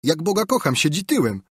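high, excited exclamation; relieved, high-pitched outburst; joyful relief. A narrator's reading. Boy joyfully relieved by what he sees.

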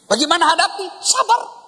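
high, squeaky comic voice; mock-weary husband. Preacher jokes about husbands taking sleeping pills, in a squeaky high voice. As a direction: pitch it way up like an exasperated husband.

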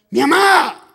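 high, very loud cry of recognition; excited shout. Retold or imitated. Boy telling playmates he heard his mother, shouting. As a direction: burst out yelling for your mother.

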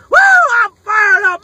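loud laugh straight into a shout; laughing whoop. Real, not acted. Collector showing a card, laugh then loud shout. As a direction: crack up, then yell it.

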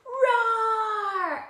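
high, drawn-out playful roar; tiger roar. Shown as a demonstration. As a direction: lead the children in a big pretend roar. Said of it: "Can you all roar with me".

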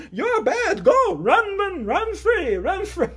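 high-pitched cheering him away; hammed-up high send-off; mock urging. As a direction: call out shrilly to someone fleeing. Brother urging baby to run away.

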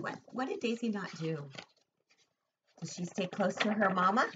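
ordinary delivery

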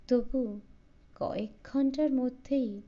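usual speaking voice

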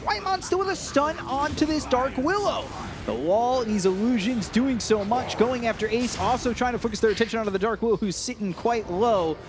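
fast excited caster call; live play-by-play. Acted. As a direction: ride the fight as it unfolds. Live team-fight call.

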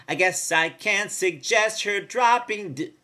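"dropping d-" slow, with a clipped D after; slow, land a tiny D after "dropping"; hinting the D. Retold or imitated. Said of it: "she does do the D".

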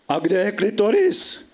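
high-pitched, climbing question; baffled question. Acted. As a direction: ask it as if utterly clueless. Narrator asks Petra, baffled.

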